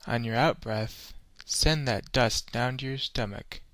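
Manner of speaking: normal talking voice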